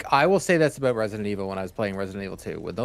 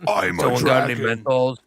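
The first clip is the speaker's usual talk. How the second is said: dragon voice; 2 semitones higher than usual. Pretend. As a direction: speak like a lumbering dragon. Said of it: "yeah, dragons talk, dude".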